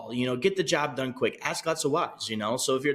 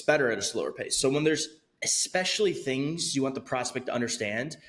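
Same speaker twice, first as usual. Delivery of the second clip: ordinary delivery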